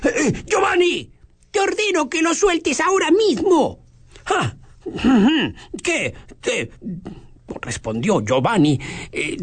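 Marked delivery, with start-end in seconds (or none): 0.0-3.9 high, commanding blazing voice; roaring crackling fire voice. Acted. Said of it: "escuchó una voz de fuego". 4.2-6.7 surprised bully. Acted. Giovanni taken aback, replying.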